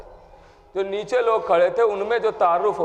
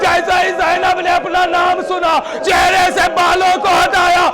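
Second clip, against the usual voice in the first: higher and louder impassioned majlis lament, building; preaching at full force; 8 semitones higher, 7 dB louder than usual. Acted. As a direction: drive the mourners to tears, climbing in pitch. Lament continues at peak.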